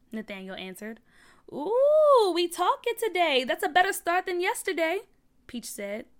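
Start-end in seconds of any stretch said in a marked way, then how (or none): 1.5-5.0 teasing delight. A narrator's reading. Peach notices Nathaniel is talking, delighted teasing. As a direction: tease a friend with excited approval.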